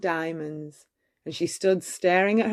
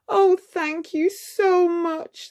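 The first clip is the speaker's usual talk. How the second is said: put-on high, slow girlish voice full of thanks; grateful exclamation; 11 semitones higher, slower than usual. A narrator's reading. Cinderella thanking her friends, high and slow. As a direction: gush thanks in a sweet high voice. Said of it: "the beautiful girl exclaimed".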